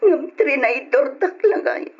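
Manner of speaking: hurt and betrayed, crying. Acted. Heartbroken girl breaks down crying.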